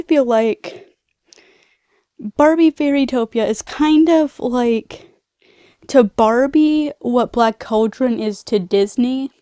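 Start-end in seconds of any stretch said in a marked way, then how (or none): none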